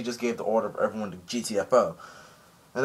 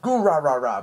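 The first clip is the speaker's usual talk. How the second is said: mock war cry; 3 semitones higher, 4 dB louder than usual. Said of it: "he doesn't actually make the noise".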